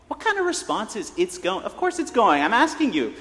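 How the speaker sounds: high, loud comic indignation; hammed-up annoyance at a useless answer; mock exasperation. Mock exasperation at stock reply.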